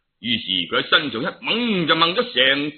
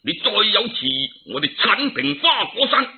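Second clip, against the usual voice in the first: threatening general; 8 semitones higher than usual. Acted. Heavenly generals threaten the monkeys.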